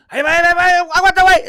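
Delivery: high, loud frantic holler; calling out in protest. Retold or imitated. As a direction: yell it out to the players, high-pitched. Acted calling out during play.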